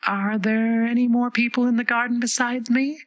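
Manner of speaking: put-on high, eager girl's voice; a curious little girl asking; young girl voice. A narrator's reading. Alice's voice.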